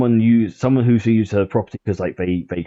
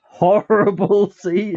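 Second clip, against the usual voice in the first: high, drawn-out words through laughter; laughing; 11 semitones higher, much slower than usual. Real, not acted. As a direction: laugh while saying it, stretched and high. Laughing at the horrible scene.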